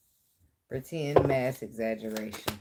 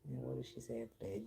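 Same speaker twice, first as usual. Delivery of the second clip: usual speaking voice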